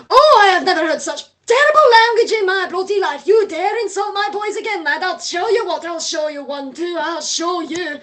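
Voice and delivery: loud, high-pitched scolding rant; angry rant. Acted. Ghost head berating Mister Peck, loud and high. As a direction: tear into the player for insulting his boys.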